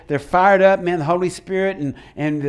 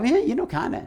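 high, soft noncommittal waffle; wishy-washy little put-on voice; mock half-hearted; 6 semitones higher, 4 dB quieter than usual. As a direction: imitate someone lukewarm, pitched up. Voicing a half-hearted candidate for deliverance.

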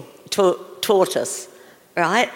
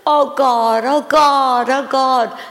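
mock anguished moaning, louder; imitating crying; 4 semitones higher, 6 dB louder, slower than usual. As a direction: lament like a grieving father over his pet. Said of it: "he's crying for an hour".

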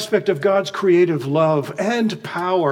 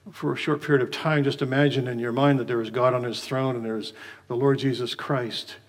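usual speaking voice

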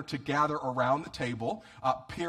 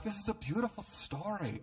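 higher, softer, sweetly imitated admiration; mock admirer; 6 semitones higher, 6 dB quieter than usual. People admiring the Bible story, mockingly sweet. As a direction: imitate people gushing, high and soft. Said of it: "I've heard people like".

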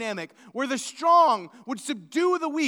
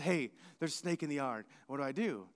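ordinary delivery

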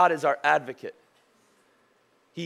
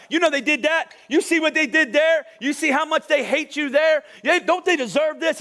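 jab at God like a prosecutor, pitched up; accusing Satan; 7 semitones higher than usual. Acted.

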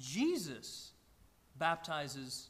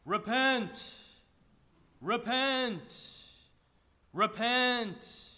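lightly voiced booming prophet, drawn-out repent; a hint of a movie prophet calling out; Charlton Heston's deep voice; 3 semitones higher, 4 dB louder, much slower than usual. Pastor imitating Heston's John the Baptist calling repent. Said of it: "Charlton Heston's wonderful, deep voice saying".

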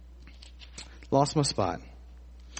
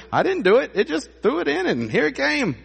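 high, pleading mock-innocent whine; lame excuse; 12 semitones higher, 3 dB louder than usual. Preacher mimics Aaron's lame excuse to Moses, whiny. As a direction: squeak out a flimsy excuse. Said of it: "the best you can come up with is".

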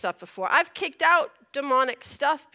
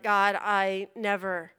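weary vow; 5 semitones lower, 9 dB quieter than usual. Retold or imitated. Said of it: "I am just like".

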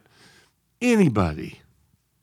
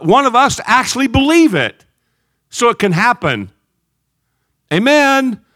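higher, louder sermon build to amen; fiery preaching; 8 semitones higher, 5 dB louder than usual. Acted. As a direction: preach it with rising force. Preaching at full force.

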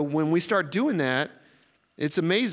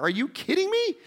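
disbelief; 13 semitones higher than usual. Retold or imitated. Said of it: "And I was like".

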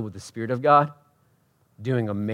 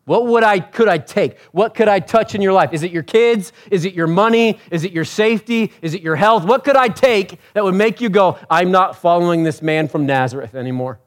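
higher, louder, pressing questions; impassioned preaching; 7 semitones higher, 9 dB louder than usual. Acted. Preacher challenging the congregation on what they'd give up, impassioned. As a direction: preach it hard, question after question.

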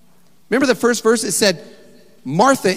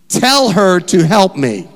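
loud, slow insistent command; demanding; 3 semitones higher, 6 dB louder, slower than usual. Acted. Martha telling Jesus to send her sister, loud and slow. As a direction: lean on TELL. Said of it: "This is Martha talking to Jesus".

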